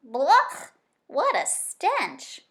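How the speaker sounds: loud, slow, revolted badger; disgusted badger. A narrator's reading. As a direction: spit out the stench in disgust. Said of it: "the badger's face twisted with disgust".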